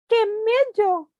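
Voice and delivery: high, slow, scared mewing; frightened cat. Acted. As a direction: whimper it up high like a terrified cat. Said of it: "meows El Gato".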